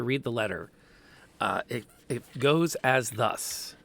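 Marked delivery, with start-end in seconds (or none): none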